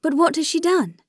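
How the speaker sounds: high-pitched, pleading question; distressed demand. A narrator's reading. Dolly asks Alexei what Anna did, in distressed demand.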